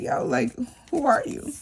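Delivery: high, incredulous mock surprise at her own savings; playful disbelief at her own bank balance; mock amazed. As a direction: act stunned, like meeting a stranger. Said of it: "I'd be like".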